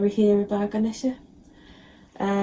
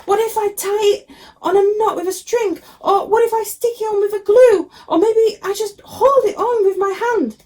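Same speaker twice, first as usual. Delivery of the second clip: put-on eager kid god; 12 semitones higher, 4 dB louder than usual. A narrator's reading. Ganesha voiced trying ideas.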